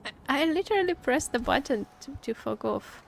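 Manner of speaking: ordinary talk, nothing marked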